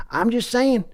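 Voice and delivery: folksy Texan owner voice, pushy; Texan drawl selling hard on a call; brash Texas accent of a team owner; Jerry Jones impression. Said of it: "That was me, Jerry Jones".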